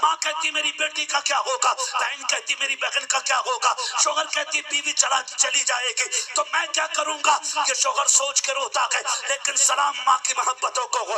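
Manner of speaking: impassioned sermon building to loud peaks over music; full-force pulpit preaching about a mother's sacrifice; fiery preaching. Acted. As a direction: preach it hard to the young men. Preacher addresses youth about mothers.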